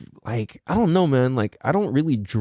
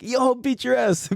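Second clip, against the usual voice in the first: mock threat; 12 semitones higher than usual. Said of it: "She was like".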